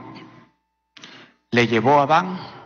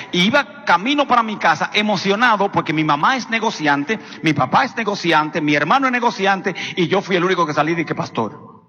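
ordinary talk

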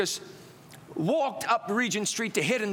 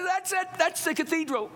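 much higher, thrilled outburst; excited; 12 semitones higher than usual. Retold or imitated. Young seeker hearing where the cathedral is, excited. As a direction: burst out as if you've found it. Eager reaction to hearing church's location.

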